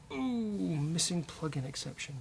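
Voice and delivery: normal talking voice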